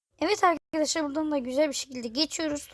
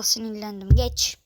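usual speaking voice

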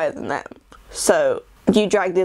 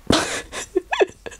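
high laugh. Real, not acted. Laugh after joke.

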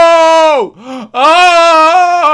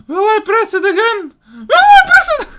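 excited rising; 7 semitones higher than usual. Real, not acted. Gamer giddily asks himself about pressing the button again. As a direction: build up giddy excitement.

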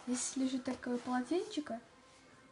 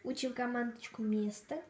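ordinary talk

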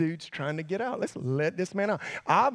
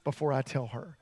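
hushed warning; 8 semitones lower, 6 dB quieter than usual. Retold or imitated. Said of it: "but he was like".

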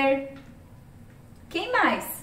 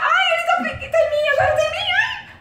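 panicked; 13 semitones higher than usual. Real, not acted. Caught by Slender.

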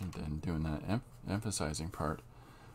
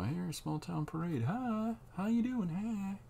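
parade greeters; 14 semitones higher, slower than usual. Pretend. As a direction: chirp a bright high greeting like a cheerful parade-goer. Voicing cheerful townsfolk at a parade.

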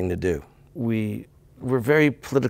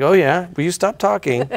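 high, louder mock interruption; dismissive brush-off; 7 semitones higher, 3 dB louder than usual. American hearing polite interest as being told to stop, mock dismissive. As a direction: cut them off with a pitched-up "oh, yeah". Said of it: "'Cause it sounded like".

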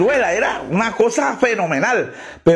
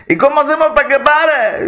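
higher, louder worried plea; panicked question; 6 semitones higher, 5 dB louder than usual. Acted. Rider on runaway camel, panicked and loud. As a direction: ask in a panic as the camel runs.

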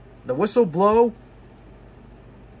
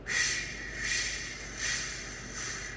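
soft unvoiced steam hiss; slinking engine puffing quietly; quiet engine shush; 12 dB quieter, much slower than usual. A narrator's reading. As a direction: breathe out a sad little shh. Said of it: "a mournful, quiet".

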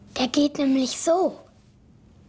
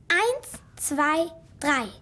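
usual speaking voice

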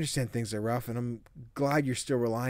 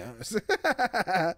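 laughing; 8 semitones higher, 3 dB louder than usual. Real, not acted. Laughing at the game's story.

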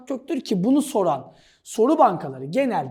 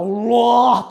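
loud, drawn-out roar; roaring lion; 6 dB louder than usual. Acted. Teacher mimics a lion that shouts Allah, loud drawn-out roar. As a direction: stretch the word into a big lion roar. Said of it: "Allah diye bağıran aslan var".

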